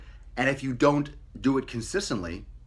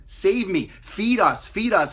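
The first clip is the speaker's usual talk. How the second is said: begging bacteria voice; 7 semitones higher, 4 dB louder, slower than usual. Acted.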